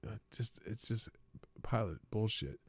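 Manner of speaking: a low grumbled aside; muttered aside. Real, not acted. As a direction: mutter it to yourself. Muttered aside after quote.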